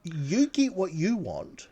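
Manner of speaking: a prim lady's imitated no; insistent refusal. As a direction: shut the offer down sweetly but firmly. Non-smoker voiced insisting.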